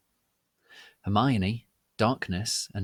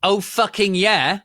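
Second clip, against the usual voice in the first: triumphant; 11 semitones higher, 8 dB louder than usual. A narrator's reading. Triumphant exclamation.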